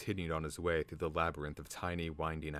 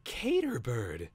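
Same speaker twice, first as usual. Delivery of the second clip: high, drawn-out amazed recognition; astonished; 6 semitones higher, 4 dB louder, slower than usual. A narrator's reading. Twig looking up at his old guardian, astonished. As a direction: stretch the name out in amazement. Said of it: "Twig looked up and gasped".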